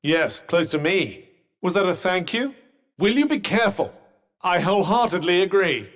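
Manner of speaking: normal talking voice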